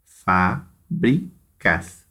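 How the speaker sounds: "Fábricas" very slowly, in three separate beats; syllable by syllable. Shown as a demonstration. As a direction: split "Fábricas" into fá, bri, cas with clear gaps. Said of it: "três sílabas".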